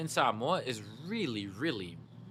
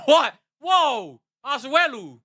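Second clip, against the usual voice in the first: much higher and louder, a burst of disbelief; shocked excited shout; 11 semitones higher, 10 dB louder, slower than usual. Retold or imitated. Reacting to a friend's blue-movie offer, shocked excited shout. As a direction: yell it like you can't believe your ears.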